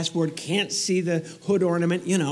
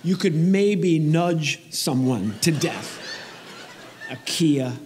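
slow deadpan; much slower than usual. Acted. Joke about weak car.

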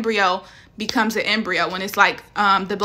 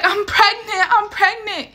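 higher, frantic repeated cry; panicked crying; 11 semitones higher, slower than usual. Retold or imitated. Dreamer telling her brother she is pregnant, high and panicked. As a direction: blurt it out high and frantic, half sobbing. Retold bawling over pregnancy test.

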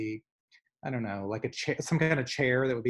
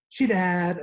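dramatic reveal; 6 semitones higher, 6 dB louder than usual. Retold or imitated. Acting out gossip punchline.

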